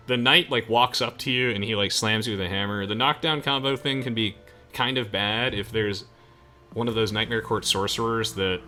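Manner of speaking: ordinary talk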